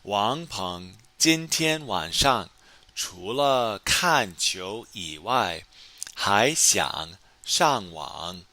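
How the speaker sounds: slow, careful model reading; slow model sentence. Shown as a demonstration. Teacher reading the answer to students, slow and careful. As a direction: spell each word out for learners. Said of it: "我们可以说，".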